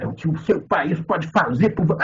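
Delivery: ordinary talk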